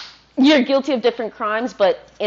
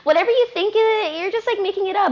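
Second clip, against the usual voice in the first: mocking retort; 8 semitones higher than usual. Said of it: "I'm like".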